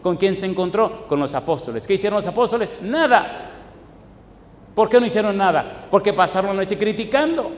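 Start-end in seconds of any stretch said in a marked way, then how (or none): 2.8-3.5 emphatic answer. Real, not acted. Preacher answers own question.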